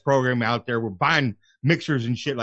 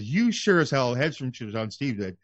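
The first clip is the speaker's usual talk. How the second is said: usual speaking voice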